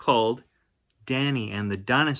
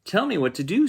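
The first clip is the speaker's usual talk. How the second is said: higher, curious dinosaur voice; dinosaur's voice; 10 semitones higher, 5 dB louder, faster than usual. Acted. Dinosaur asking Danny about manners, curious and higher. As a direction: play the dinosaur asking, wide-eyed.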